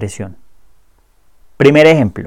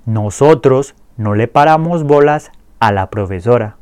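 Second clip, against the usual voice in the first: clear repeat-after-me drill. Shown as a demonstration. Teacher drills the conjugations for students to repeat, clear and steady. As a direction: say each line clearly for learners to echo. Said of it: "Repitan después de mí".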